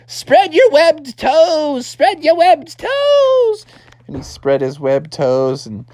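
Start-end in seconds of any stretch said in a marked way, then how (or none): 0.1-3.7 high, slow drawn-out call; hammed-up call climbing higher on the repeat; frog dad shouting instructions. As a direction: holler each word to your falling son. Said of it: "his dad was like".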